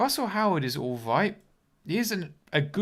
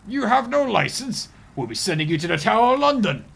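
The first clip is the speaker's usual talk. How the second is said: pompous British official; 7 semitones higher, 8 dB louder than usual. Acted. Streamer mimicking officials demanding a licence, pompous British official. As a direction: bellow it like an outraged official passing sentence. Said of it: "I'm not very good at accents".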